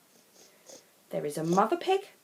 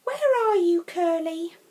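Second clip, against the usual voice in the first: high, loud call across the farm; 10 semitones higher, 7 dB louder than usual. Acted. Children calling out across the farm for Curly, loud and high. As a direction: shout for the lost piglet.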